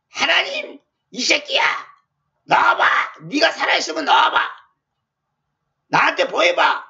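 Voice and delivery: mock challenge hurled at God; retold angry taunting in short bursts; imitated defiant rant. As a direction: act out a rebel daring God to show himself. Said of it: "삿대질을".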